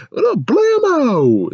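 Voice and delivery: very high, slow squeaky greeting; mock cartoon greeting. Squeaky high cartoon greeting to Blammo. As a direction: ham up a cartoon character calling out. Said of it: "Robot Chicken was like".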